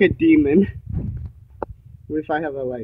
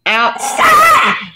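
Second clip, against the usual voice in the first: very high sing-song call to a dog; high-pitched call. Real, not acted.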